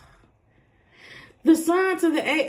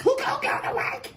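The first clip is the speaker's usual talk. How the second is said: high-pitched indignant comeback; acted high-voiced pushback; mock protest; 8 semitones higher than usual. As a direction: voice their objection in a high whine. Voicing the querent's protest.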